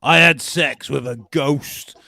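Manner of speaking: loud, slow Liverpudlian twang; Cheshire accent. Acted. Quoting reality star's ghost confession, exaggerated Cheshire accent. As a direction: proclaim it in a hammed-up Cheshire accent. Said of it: "I was gonna say a bit Liverpudlian".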